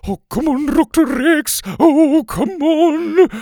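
very high, wailing plea; distraught pleading. Acted. Sloppy Joe pats unconscious Doctor Rex's cheeks, wailing plea. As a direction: beg them to wake, rising to a loud stretched cry.

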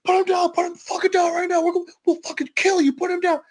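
high, urgent police commands; cops shouting orders. Retold or imitated. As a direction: bark it with guns drawn. Cops burst in with guns.

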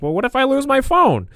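high-pitched older-mother impression; mom voice. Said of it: "it's just my mom voice".

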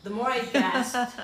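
fighting back tears while reading; getting emotional. Real, not acted. Reading her own painful story, getting emotional. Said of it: "Sorry, I'm getting emotional".

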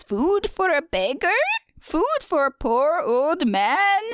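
put-on high, drawn-out old man's begging. Mysterious cloaked beggar asks for food, old man voice. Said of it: "I like your old man voice".

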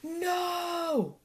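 high, long cry without much volume; drawn-out scream. A narrator's reading. Badger waking from a bad dream, long high scream. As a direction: stretch it out high, a restrained scream. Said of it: "screamed Grumpy Badger".

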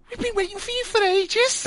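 very high, camp drag voice; camp drag queen voice. Retold or imitated. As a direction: gush it out high and flamboyant like a drag contestant. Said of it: "the accent I'm gonna put on now".

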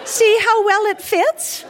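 high, slow, sugary taunt; sinister sweet. A narrator's reading. Bride after stabbing groom.

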